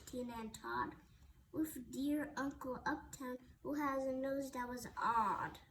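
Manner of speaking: usual speaking voice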